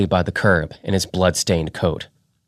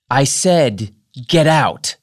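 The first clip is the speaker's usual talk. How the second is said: angry order; 7 semitones higher, slower than usual. Acted. Angry woman ordering boy out.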